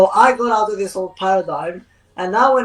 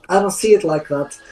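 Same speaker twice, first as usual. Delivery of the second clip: normal talking voice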